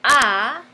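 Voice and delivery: loud, drawn-out "A"; emphatic tonic A. Shown as a demonstration. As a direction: punch the isolated "A" as a stressed sound. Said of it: "empieza con el sonido tónico".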